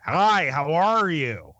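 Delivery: slow, overdone fake American accent; mock bad American accent. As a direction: parody a Brit faking American, slowly. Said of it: "terrible American accent on that guy".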